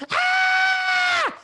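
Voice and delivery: piercing, very high, loud shriek; the girl screaming again; girl's scream. Retold or imitated. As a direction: scream in horror.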